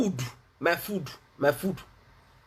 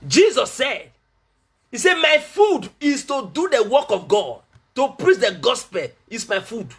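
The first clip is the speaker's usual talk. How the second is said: raised preacher's proclamation; emphatic preaching; 5 semitones higher than usual. Real, not acted. Preacher quoting Jesus on doing God's work, emphatic preaching. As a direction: declare it firmly to the congregation.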